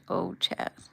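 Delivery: a wife in hospital sobbing out her relief to her husband; crying. Acted. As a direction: let the tears break through as she speaks. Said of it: "His words started the fountain of tears".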